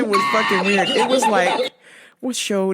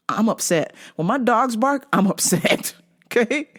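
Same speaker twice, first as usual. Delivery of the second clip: ordinary talk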